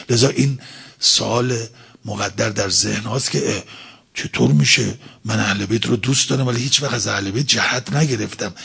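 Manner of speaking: normal talking voice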